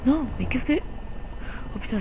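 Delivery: sudden fright, then a breathy gasp; real scare at the wheel; startled. Real, not acted. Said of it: "j'ai eu peur, là".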